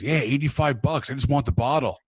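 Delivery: excited bragging. Retold or imitated. Metal fan showing off his bottle of wine, excited.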